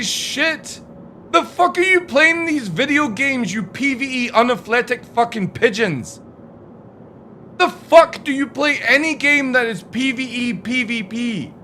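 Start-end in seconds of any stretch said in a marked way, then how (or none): none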